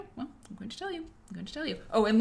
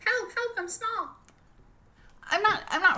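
squeaky high little cry for help; tiny voice sound effect; 13 semitones higher than usual. Pretend. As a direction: shrink into a tiny helpless voice. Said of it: "Okay, watch".

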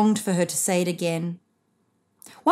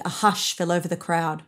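usual speaking voice

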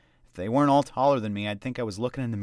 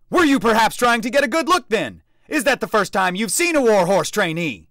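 loud, high sneering shout; mocking yell; 11 semitones higher, 9 dB louder than usual. A narrator's reading. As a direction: taunt him at full volume.

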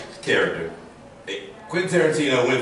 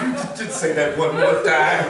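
laughing plea; 5 semitones higher than usual. Retold or imitated. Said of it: "I go".

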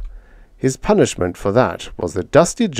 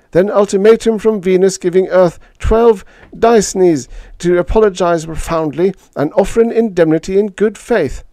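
tense urgent report, raised voice; grim ambassador; 9 semitones higher, 6 dB louder than usual. A narrator's reading. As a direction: rattle off the crisis, tense. Ambassador reads out grim news.

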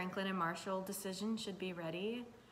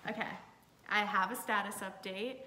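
ordinary talk

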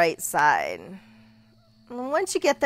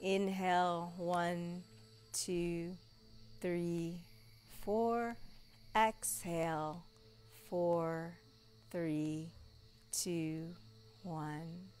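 unhurried counting, fading softer; slow breath count; 4 semitones lower, 12 dB quieter, much slower than usual. Shown as a demonstration. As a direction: count each breath slowly. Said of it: "helpful to even count".

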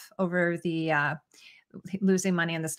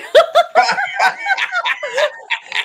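a shrieking burst of laughter, much higher and louder; 9 dB louder than usual. Real, not acted. Host cracking up at a viewer's mix-up with a loud shrieking laugh. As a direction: let out a big squealing laugh.

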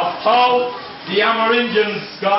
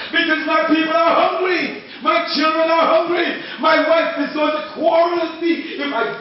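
a chief shouting his plea for a deer; desperate prayer; 9 semitones higher than usual. Acted. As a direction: beg the god at full voice. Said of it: "And he was thinking".

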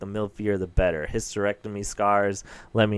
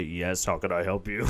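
lower, gruffer answer; deep reply; 3 semitones lower than usual. Retold or imitated. Misgendered worker answering customers, low voice. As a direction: drop the voice to throw them off. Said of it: "And I say".

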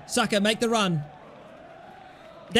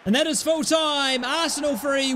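normal talking voice

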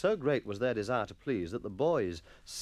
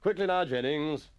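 raised, somewhat louder master's voice; brisk schoolmaster's order; 4 semitones higher, 5 dB louder than usual. A narrator's reading. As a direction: order a dawdling pupil along. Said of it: "he ordered".

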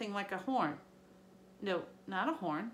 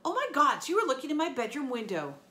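embarrassed shock; 6 semitones higher, 6 dB louder than usual. A narrator's reading. Embarrassed realization.

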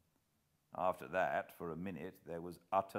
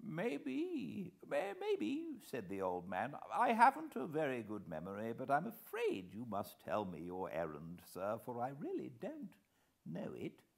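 put-on higher old gentleman, courteous and smooth; old host, polite; 6 semitones higher than usual. A narrator's reading. Old host speaks to Hannay, polite and higher. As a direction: usher the visitor away from his guests.

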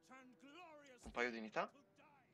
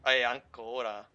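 angry; 9 dB louder than usual. Real, not acted. Losing cities, frustrated.

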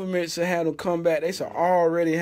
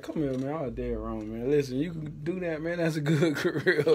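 softer, lower calm-down voice; calm apology; 4 semitones lower, 6 dB quieter than usual. Retold or imitated. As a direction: settle it down, make peace. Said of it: "had to calm down and be like".

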